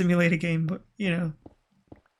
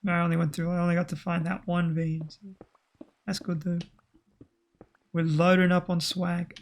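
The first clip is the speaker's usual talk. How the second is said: usual speaking voice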